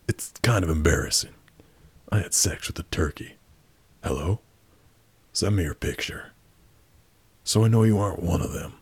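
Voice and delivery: ordinary talk, nothing marked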